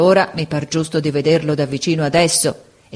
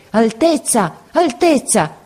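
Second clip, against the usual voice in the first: mild narrated shout, rising higher the second time; crying out; 6 semitones higher, slower than usual. A narrator's reading. Soldier recalls hearing men cry out to the prince, mild high shout. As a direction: call it out high, without full force. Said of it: "Io intesi gridare".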